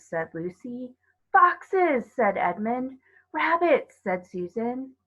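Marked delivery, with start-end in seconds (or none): 1.3-2.1 higher, louder eager call; keen child naming an animal; excited. A narrator's reading. 3.3-4.0 excited. A narrator's reading. Children eagerly naming animals.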